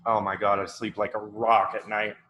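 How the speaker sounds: ordinary talk, nothing marked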